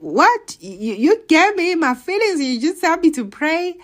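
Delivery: high, loud indignant disbelief; worked-up squeal of disbelief; incredulous outburst. Retold or imitated. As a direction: protest as if cheated. Acting out disbelief at God's answer.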